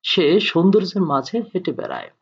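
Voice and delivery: normal talking voice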